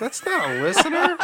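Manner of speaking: giggling protest; laughing. Real, not acted. Host laughing that Dan is no real listener, laughing.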